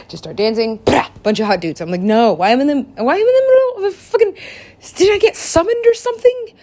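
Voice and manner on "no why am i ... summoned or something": high-pitched, loud comic outrage; bewildered protest. Retold or imitated. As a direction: protest in a high, incredulous squeal. Acted bewildered protest at being summoned.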